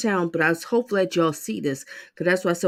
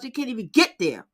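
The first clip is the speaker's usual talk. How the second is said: usual speaking voice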